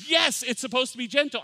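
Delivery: high, loud exclaimed yes; emphatic retold agreement. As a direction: burst out in exasperated agreement. Brother-in-law's emphatic reply, retold.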